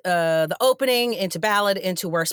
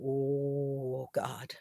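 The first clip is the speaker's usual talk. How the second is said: whispered, much softer and lower groan; hushed dread; 8 semitones lower, 11 dB quieter than usual. Retold or imitated. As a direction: breathe it out in quiet dread. Cast bracing for an outburst.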